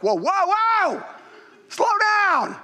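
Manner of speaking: high, drawn-out whoas; calling a halt. Retold or imitated. Sergeant stopping his excited trainee, high drawn-out call to slow down. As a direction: rein in someone racing ahead.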